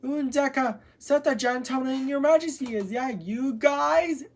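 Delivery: high-pitched, excited calling out; excited storybook heroine, voice lifted high; excited holler. A narrator's reading. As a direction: call out in delighted recognition, pitched high. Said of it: "she hollered excitedly".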